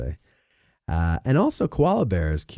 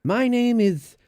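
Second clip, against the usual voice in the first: mock nerd; 11 semitones higher, slower than usual. Host mocking nerds introducing themselves, high caricature voice. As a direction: pitch it up like a hammy fantasy nerd. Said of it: "Why do nerds love the name".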